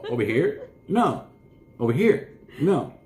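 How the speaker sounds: high, teasing questions and answers; playful dog talk. Pretend. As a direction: play-act talking with the dog.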